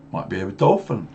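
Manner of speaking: usual speaking voice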